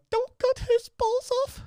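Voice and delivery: very high, slow mock English queen; badly faked royal English accent, piercingly high and drawn out. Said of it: "I can't do a fucking English accent".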